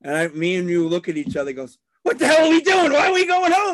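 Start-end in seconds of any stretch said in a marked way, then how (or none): 2.0-3.7 high, loud retold shout; acted incredulous outburst. Two friends leaving the bar, acted incredulous shout. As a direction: yell it like an outraged drunk friend.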